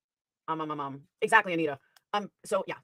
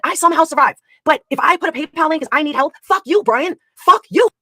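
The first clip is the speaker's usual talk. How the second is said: loud, raised-pitch real anger; furious tirade; 3 semitones higher, 6 dB louder than usual. Real, not acted. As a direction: rail at him, hammering out each grievance. Angry tirade at Brian over money.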